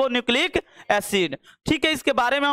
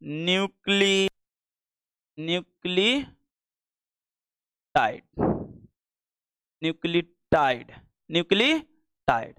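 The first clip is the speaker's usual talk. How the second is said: slow drawn-out syllables; 6 semitones lower, slower than usual. Shown as a demonstration. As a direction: spell nucleotide aloud for the class, slowly and low. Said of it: "और क्या?".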